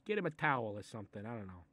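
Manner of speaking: usual speaking voice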